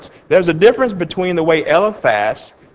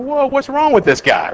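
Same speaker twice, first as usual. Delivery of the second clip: higher-pitched mock disbelief; startled onlooker; 9 semitones higher than usual. Onlooker sees Job going off, mock startled disbelief. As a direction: react in pitched-up bafflement. Onlooker reacting to Job's outburst.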